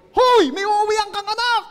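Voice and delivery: loud, slow, high scold; loud slow rebuke about going home; scolding shout. Real, not acted. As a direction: call them out sharply. Scolding overworked listeners.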